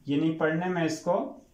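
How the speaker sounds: ordinary delivery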